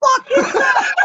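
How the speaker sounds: high, laughing shout of alarm; laughing shocked yell. Retold or imitated. Telling a friend about a hissing cage beast, laughing shout. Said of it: "and I'm like".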